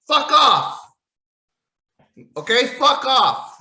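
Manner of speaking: loud, high exasperated yell; fed-up outburst; yelled fuck off. Real, not acted. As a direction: shout it at the screen. Outburst at the wrestlers.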